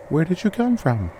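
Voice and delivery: put-on high childlike question; young girl voice. A narrator's reading. Girl peppers the stranger with questions, high childlike voice. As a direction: ask it like a curious little girl.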